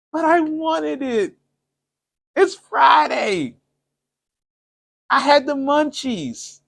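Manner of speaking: put-on high, drawn-out childlike whine; mock whining. Dieter excusing forbidden food to viewers, mock whining. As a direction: plead your excuse slowly, way up high.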